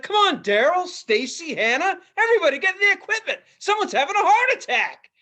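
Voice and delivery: hammed-up boss calling the whole crew to the truck; mock fire chief yell. Fire chief rallying crew, mocked.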